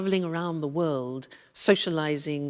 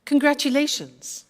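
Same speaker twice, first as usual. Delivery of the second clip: cheerful; 8 semitones higher, 4 dB louder than usual. Shown as a demonstration. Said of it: "What do you say".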